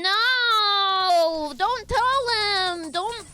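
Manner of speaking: high, slow, strained begging voice; mock panicked plea. Streamer acting out a panicked character's plea. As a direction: beg desperately, hammed up. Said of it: "trying to do a bit".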